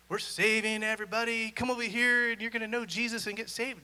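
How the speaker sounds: loud, high, preachy proclaiming; hammed-up crowd-saving pitch; mock banner-waving shout. Evangelist mocking pushy street preachers, hammed-up loud shout. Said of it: "wave the banner of".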